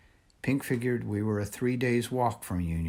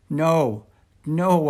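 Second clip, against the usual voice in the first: a higher, louder desperate plea; begging; 6 semitones higher, 9 dB louder than usual. A narrator's reading. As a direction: cry out to the guards dragging them. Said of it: "I begged".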